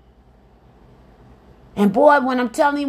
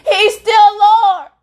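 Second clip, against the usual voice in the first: emphatic proclamation; 8 semitones higher, 6 dB louder, slower than usual. Real, not acted. Preacher proclaiming to congregation.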